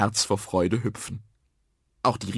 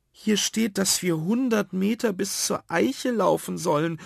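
put-on high-pitched child reading out; child calling out; 10 semitones higher than usual. Ada reads the treasure map out, calling. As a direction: call out the directions in a high voice. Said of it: "rief Ada".

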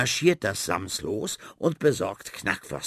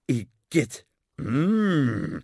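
disgusted; 3 semitones higher, 4 dB louder, much slower than usual. Acted. Disgust at strange dishes.

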